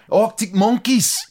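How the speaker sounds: very high, stretched northern English vowels; imitated northern accent. Host imitating how he said it, slow high northern accent. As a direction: draw the name out high in his northern accent. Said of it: "He goes".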